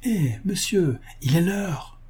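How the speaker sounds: a servant gently rousing his master; calling the master. A narrator's reading.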